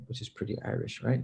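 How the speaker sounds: normal talking voice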